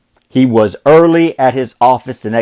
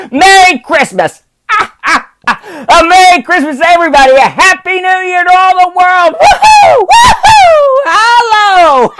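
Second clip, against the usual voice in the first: put-on high, laughing, shouting old man; giddy joyful Scrooge; 14 semitones higher, 4 dB louder than usual. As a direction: whoop it out like a drunken schoolboy. Scrooge bursts with joy.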